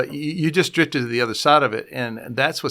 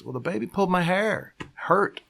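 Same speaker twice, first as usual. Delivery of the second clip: put-on higher, softer complaining child voice; whiny little boy; 6 semitones higher, 3 dB quieter than usual. Little boy runs to his mom, whining complaint. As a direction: play the hurt boy telling on the baby.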